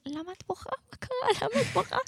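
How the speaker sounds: high, anxious questions to a crying friend; comforting a crying new girl, high and worried; worried asking. Retold or imitated. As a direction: pitch way up, fretting over her. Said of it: "ואני אומרת".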